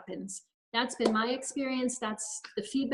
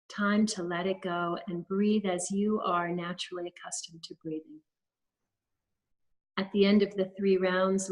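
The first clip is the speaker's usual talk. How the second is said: guided meditation. Acted. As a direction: lead them gently through each breath, unhurried. Leads a guided breathing practice.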